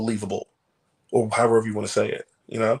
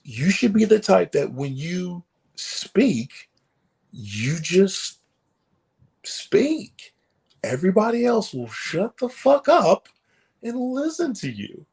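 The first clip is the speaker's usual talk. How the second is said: imitating a mentor's higher, measured lecture; commanding lecture; 6 semitones higher, slower than usual. Mentor telling a young wrestler to command the room, commanding lecture. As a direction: lay it down slowly, pausing for weight. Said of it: "And he goes".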